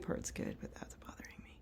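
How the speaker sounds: unvoiced whisper, under her breath. Real, not acted. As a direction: breathe it out softly to herself. Whispering while working.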